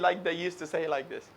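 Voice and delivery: usual speaking voice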